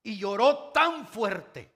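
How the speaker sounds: slow and loud, leaning hard on "tan"; preacher pounding "tan fuerte"; stress on tan. Acted. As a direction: drag out "tan fuerte" for weight.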